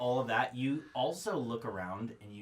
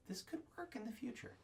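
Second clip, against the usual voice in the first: very soft, higher-pitched thought; tentative hope; 10 semitones higher, 15 dB quieter than usual. Acted. GM voicing the character's sense of potential, hushed tentative hope. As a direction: lift the pitch and barely voice it. GM voices a hopeful inner thought.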